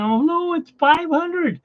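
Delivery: can't believe the number under the coin; stunned excitement. Retold or imitated. On the phone with friend Alan, slow high astonished excitement. Retelling a bigger win.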